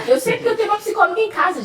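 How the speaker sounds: quick, high, laughing aside; fast giggly confession; laughing joke. Real, not acted. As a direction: tease her through a laugh. Jokes about the psychologist in the room.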